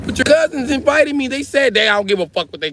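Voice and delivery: put-on high girl talking back; Natasha voice. Natasha answering the speaker.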